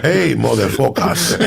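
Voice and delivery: put-on "Hey, motherfuckers" slow, in a Spanish accent; a stepfather trying to sound cool with the kids; lightly voiced Cuban stepfather, slow; stepfather's bad English. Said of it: "it would sound so horrible how he said it".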